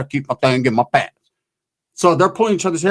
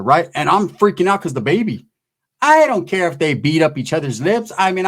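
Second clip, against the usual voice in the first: ordinary talk, nothing marked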